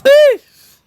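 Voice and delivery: a sharp, very high squeal of disbelief; shocked eesh. Real, not acted. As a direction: let out a sky-high "eesh" at the price. Shocked at courtside ticket prices.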